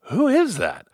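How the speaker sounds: retelling the group's baffled question; puzzled who is that. Retold or imitated. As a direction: ask it like a stranger just spoke. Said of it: "we all listened and went".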